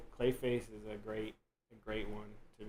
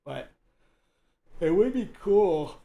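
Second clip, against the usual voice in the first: talking through a yawn; words stretched by a yawn; 7 semitones higher, 10 dB louder, slower than usual. Real, not acted. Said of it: "Oh my God, I'm yawning".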